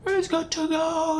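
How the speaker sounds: high, long called-out shout up the cliff; mock shout. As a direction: call it up to the climber far above. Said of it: "he needs to be shouting".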